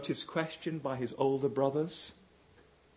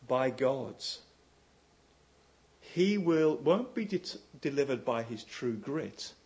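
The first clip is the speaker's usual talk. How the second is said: ordinary talk, nothing marked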